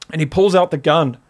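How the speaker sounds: usual speaking voice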